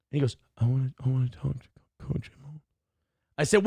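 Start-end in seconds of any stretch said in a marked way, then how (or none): 0.6-2.6 a light, meek imitation of the caller; mock whimper. Imitating the timid caller asking for Coach Mo, mock whimper. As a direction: mumble it like a timid man. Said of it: "They were talking in a whimper".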